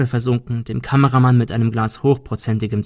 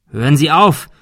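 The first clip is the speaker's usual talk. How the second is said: higher, louder urgent call; 4 semitones higher, 7 dB louder than usual. A narrator's reading. Thomas calls to the gunman, urgent shout. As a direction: shout to make him stop. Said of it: "rief Thomas".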